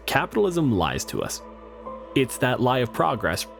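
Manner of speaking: normal talking voice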